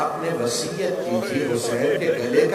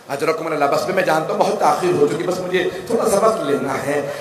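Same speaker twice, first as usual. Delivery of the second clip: ordinary talk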